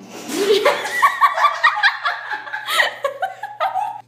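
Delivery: long high shrieking laughter; helpless high cackle. Real, not acted. Listeners howling at the romance line, shrieking laugh. Reader breaks after dialogue.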